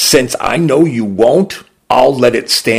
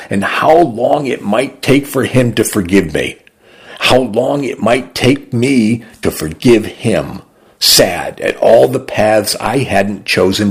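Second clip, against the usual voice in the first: ordinary delivery, nothing marked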